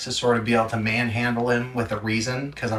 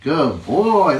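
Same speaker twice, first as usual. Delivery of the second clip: loud excited praise; 8 dB louder, much slower than usual. Real, not acted. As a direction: cheer the pup on. Praising puppy loudly.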